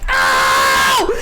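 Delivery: loud, sudden "ow" scream; real yelp of pain. Real, not acted. Streamer who just kicked herself, loud pained yelp. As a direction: scream out as the foot gets kicked.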